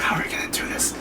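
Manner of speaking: hushed, acted whisper; conspiratorial whisper. King conferring with the brother, hushed whisper. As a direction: whisper it like a plotter.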